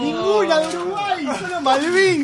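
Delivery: high, dismayed wail; exasperated outcry. Real, not acted. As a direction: cry out in exasperation at the miss. Host despairs at wrong answer.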